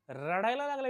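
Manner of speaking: high, swooping mock lament; mock-dramatic. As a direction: stretch it upward like a theatrical wail. Mock-dramatic retelling of crying.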